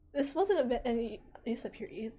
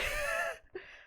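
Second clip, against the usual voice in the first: high laugh. Real, not acted. Laughs at item name.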